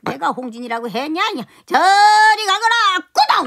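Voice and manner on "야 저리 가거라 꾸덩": loud shove-off shout. Acted. Master shoves servant away.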